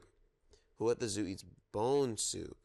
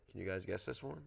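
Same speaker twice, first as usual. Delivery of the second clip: ordinary delivery, nothing marked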